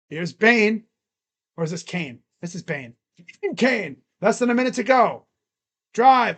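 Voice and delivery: a streamer narrating the final minute of the game; live play-by-play. Acted. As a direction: call each play as it happens.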